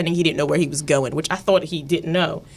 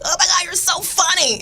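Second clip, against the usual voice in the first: gushing fan voice; 12 semitones higher than usual. Retold or imitated. Imitating people who gush.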